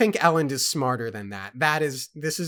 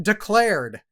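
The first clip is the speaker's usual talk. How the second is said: loud, high-pitched proclamation; playful shouted announcement; mock yell; 8 semitones higher, 8 dB louder than usual. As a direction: call it out like a hasty official. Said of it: "could just yell out".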